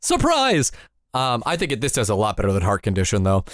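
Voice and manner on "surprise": high hammed-up surprise; mock surprise. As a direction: sing out a fake surprise. Mock movie announcement.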